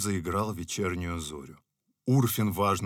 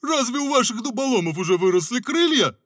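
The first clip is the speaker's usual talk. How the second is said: higher, louder mock question; sly teasing; 9 dB louder than usual. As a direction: tease the besieger. Scarecrow teases. (лукаво-насмешливо)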